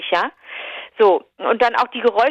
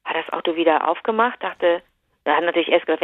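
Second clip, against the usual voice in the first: normal talking voice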